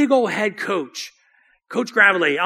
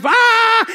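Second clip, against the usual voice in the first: high, loud "ah" of a crowd; imitated crowd roar; 13 semitones higher, 6 dB louder than usual. Player recalls the crowd erupting, high loud roar. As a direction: mimic a stadium crowd erupting.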